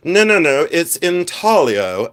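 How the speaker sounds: slow, haughty intaglio, G dropped, in-TAL-io; mock-posh collector setting me straight; snooty correction. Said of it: "tip their top hat to me".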